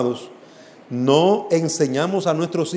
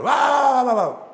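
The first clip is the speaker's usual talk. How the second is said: drill shout; 7 semitones higher than usual. Retold or imitated. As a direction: yell it like a drill sergeant pushing recruits. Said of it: "la gente le grita al otro".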